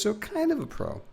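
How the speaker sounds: squeaky high ironic brag; mock boast. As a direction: pitch it way up, jokingly smug.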